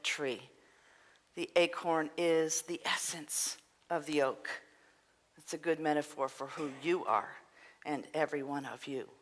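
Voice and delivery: gently hushed preacher, half-whispering; hushed preaching. Acted. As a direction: drop to a hush and let it sink in. Soft reflective preaching passage.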